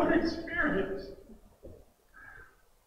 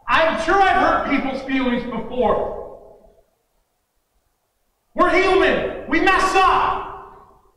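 loud, high, impassioned sermon delivery; full-force preaching; 6 semitones higher, 7 dB louder than usual. Real, not acted. Preacher urging the hurt and offended toward Jesus, forcefully impassioned. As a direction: hammer each phrase out to the congregation.

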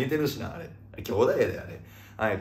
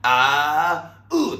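loud drawn-out vowels jumping higher; imitated comedian's wail; 3 semitones higher, 7 dB louder, much slower than usual. Host acting a comedian struggling to play, imitated wail. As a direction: wail out two long high notes. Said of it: "で、堤下さんが".

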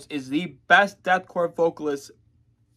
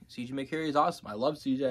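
usual speaking voice

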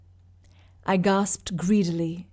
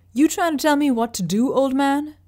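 higher, louder, forced through the teeth; ground out in anger; 6 semitones higher, 4 dB louder than usual. Acted. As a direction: push each word out with barely held fury. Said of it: "I ground out slowly".

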